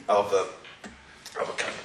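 hoarse, croaky from a cold. Real, not acted. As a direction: talk through a croaky throat. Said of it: "sorry about my croaky voice".

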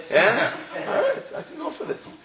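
laughing, high and breathy; amused lobster. Acted. Lobster laughing as the pot warms, amused. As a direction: chuckle through it, pleased with the heat. Lobster enjoying hotter water.

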